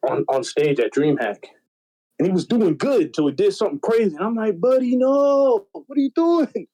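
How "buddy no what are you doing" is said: dismayed cry. Retold or imitated. Yelling at screen at a friend.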